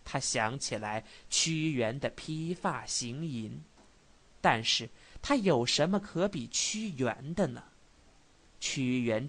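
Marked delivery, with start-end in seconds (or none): none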